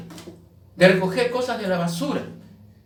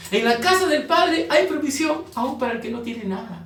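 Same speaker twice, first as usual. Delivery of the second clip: preaching proclamation; 6 semitones higher than usual. Acted. Preacher proclaims provision to the congregation, raised and ringing. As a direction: ring it out high like a sermon.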